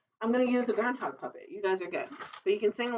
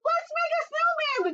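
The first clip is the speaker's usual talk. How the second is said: put-on very high eager children's call; excited cry. Children inviting the groundhog to play, very high and eager. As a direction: burst out with delight. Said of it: "the children cried".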